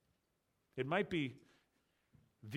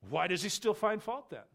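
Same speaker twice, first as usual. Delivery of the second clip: accusing; 5 semitones higher than usual. Acted. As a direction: challenge it, voice pitched up. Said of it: "Notice the tone of voice".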